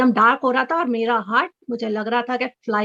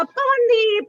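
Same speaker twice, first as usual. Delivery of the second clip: high, excited call of the name; excited shout of a name; 10 semitones higher, much slower than usual. Retold or imitated. Fan calling out to her idol [name], high and excited. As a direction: call out to the singer you adore. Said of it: "मैंने चिल्लाना शुरू किया".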